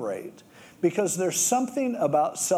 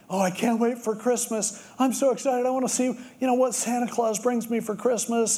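put-on higher, eager little-kid gush; excited kid; 7 semitones higher than usual. Preacher voicing a kid who can't wait for Christmas, eager and higher. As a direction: bubble over like a kid before Christmas.

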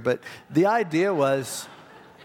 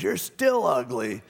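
defiant parrot, pitched up; high, taunting talking-bird voice; 7 semitones higher than usual. Retold or imitated. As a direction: draw out the insult like a stubborn bird. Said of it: "He goes".

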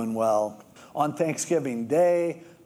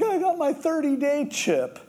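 much higher, beaming announcement; proud; 11 semitones higher than usual. Retold or imitated. Adult in recovery telling friends, beaming pride. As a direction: show off the chip like a child.

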